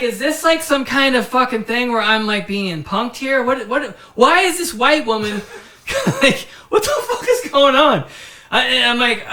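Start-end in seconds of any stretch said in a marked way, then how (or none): 0.0-8.1 high, loud incredulous quote, half laughing; mock-exasperated, raised voice; retold bewilderment. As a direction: act out baffled disbelief. Retold bewilderment at being punked.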